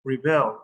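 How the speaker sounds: rebel as re-BEL, weight on the end; stress on second syllable. Shown as a demonstration. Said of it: "This one right here is pronounced".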